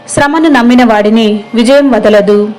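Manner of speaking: ordinary delivery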